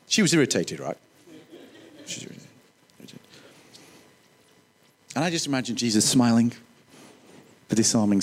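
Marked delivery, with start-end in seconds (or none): 2.1-3.2 unvoiced whisper, slow; whispered aside. Real, not acted. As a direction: murmur it as a secret.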